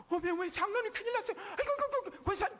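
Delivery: high, fast flustered panic; hammed-up frightened stammer; mock panic. Pastor mocking a panicked believer, hammed-up fear. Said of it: "그러든가요".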